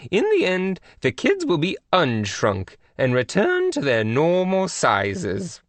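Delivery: lofty high royal tone; queenly voice. A narrator's reading. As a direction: scold gently with royal poise. Queen of England on phone.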